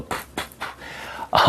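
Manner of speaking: slow unvoiced puffs like a pump. Shown as a demonstration. Mocking a guy pumping up a balloon, puffs. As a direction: puff out air slowly, pff pff. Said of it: "don't walk up and go".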